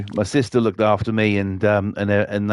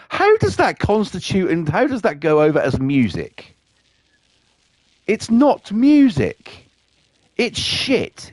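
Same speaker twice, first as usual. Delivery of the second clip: scornful disbelief; 9 semitones higher than usual. Real, not acted. Ranting about the song.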